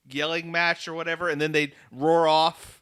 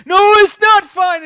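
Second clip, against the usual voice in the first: mock shout; 13 semitones higher, 12 dB louder than usual. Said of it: "it's like".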